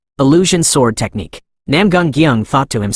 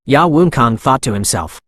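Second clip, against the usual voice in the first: plain reading voice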